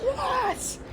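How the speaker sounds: very high, hushed squeal; inner scream. Retold or imitated. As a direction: squeal it softly, like a scream kept inside the head. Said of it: "screams inside his head".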